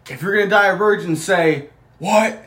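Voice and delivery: loud high wrestler taunt; wrestler promo voice. Retold or imitated. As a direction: taunt the crowd like a heel. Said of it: "they're like".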